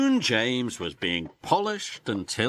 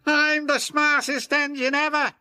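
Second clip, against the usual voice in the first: high, loud boastful engine voice; proud James; 6 dB louder than usual. Acted. James passing cheering crowds, high boastful voice. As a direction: puff up with pride. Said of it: "James puffed proudly".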